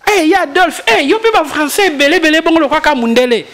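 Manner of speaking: mock excited friend. Friend teasing him on the phone.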